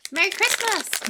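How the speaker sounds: high, loud festive call; festive cheer. Pretend. Streamer greeting viewers for Christmas, excited high call.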